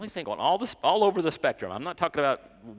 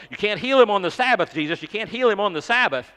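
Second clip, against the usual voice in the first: loud, high, scolding protest; the religious leaders scold Jesus, loud and high; indignant protest; 8 semitones higher, 9 dB louder than usual. Retold or imitated. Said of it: "religious leaders are going".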